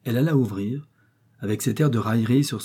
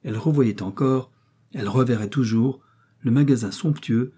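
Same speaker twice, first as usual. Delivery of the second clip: ordinary delivery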